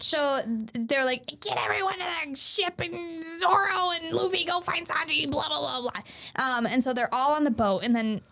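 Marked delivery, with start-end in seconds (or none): 1.4-6.0 high-pitched imitation of crew orders; mimicked bossy instructions; mock rattled-off orders. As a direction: reel off the plan, trailing into filler. Acting out hurried crew orders.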